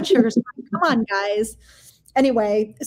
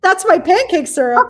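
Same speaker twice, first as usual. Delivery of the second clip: excited reveal; 8 semitones higher, 7 dB louder than usual. Retold or imitated. Reveal to friend who loves Switch.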